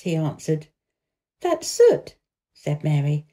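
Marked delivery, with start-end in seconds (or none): 1.4-2.1 a higher, lightly voiced young girl; girl's voice. A narrator's reading. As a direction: read Mary as an eager little girl, pitched up. Mary voiced in a story.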